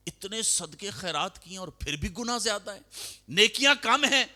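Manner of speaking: rising to a high, loud cry of disbelief; dismayed protest. Acted. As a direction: plead that your charity must count. Narrator voices dismayed protest.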